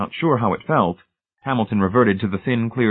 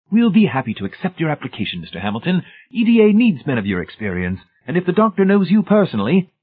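high, loud, jovial official; beaming director voice; 9 semitones higher, 4 dB louder than usual. A narrator's reading. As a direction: beam at the new hire. Beaming personnel director speaks.

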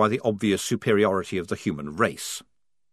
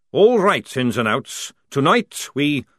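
sergeant's order; 5 semitones higher, 6 dB louder, slower than usual. Acted.

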